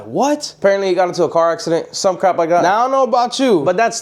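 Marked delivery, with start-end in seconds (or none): 0.0-0.6 higher, disbelieving; stunned what. Retold or imitated. [name] hears Carlos died, stunned. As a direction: react to the news of his death. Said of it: "I was like".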